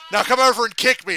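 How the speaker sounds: hammed-up shouted command; mock shouted order. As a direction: call it out across the ring. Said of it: "Punk is shouting at everyone".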